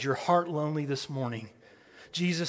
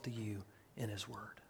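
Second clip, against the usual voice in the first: drops to a whisper; unvoiced hush to the congregation; 4 semitones lower, 15 dB quieter than usual. Real, not acted. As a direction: whisper it close, intimate.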